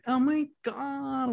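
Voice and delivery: high, slow hammed-up porn gasp; mock breathy gasp. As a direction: drag it out like a cheesy porn actress. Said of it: "and she's like".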